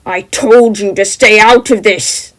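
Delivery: slow, loud menacing rage; enraged yell. Acted. The figure yells at Jem for meddling, enraged. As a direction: let the anger burst out slowly. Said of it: "yells, bursting with rage".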